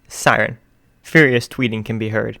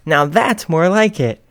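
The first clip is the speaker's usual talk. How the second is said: higher, louder, slow satisfied host; radio host; 7 semitones higher, 7 dB louder, slower than usual. Acted. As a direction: savor it like a showman.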